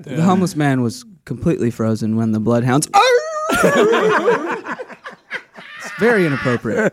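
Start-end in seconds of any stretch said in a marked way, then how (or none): 0.0-2.9 low, sober mock reporting; imitating a solemn story; serious storyteller. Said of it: "telling a serious story". 2.9-5.1 long high mock hound howl; bay like a hound; high drawn-out "arooo"; bloodhound howl. Bloodhound howl interrupts again.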